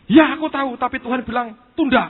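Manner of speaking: high, loud excited reply; Yusak explaining. Retold or imitated. Yusak telling his fiancée God said wait, high and loud.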